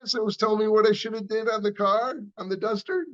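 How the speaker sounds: high, rising, disbelieving; incredulous recognition. Retold or imitated. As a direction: realize it's that same guy. Retold recognition of old caller.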